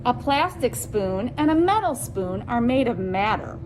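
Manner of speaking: ordinary delivery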